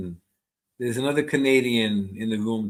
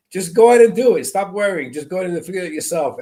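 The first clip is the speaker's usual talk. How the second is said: impatient mom; 6 semitones higher, 6 dB louder, faster than usual. Retold or imitated.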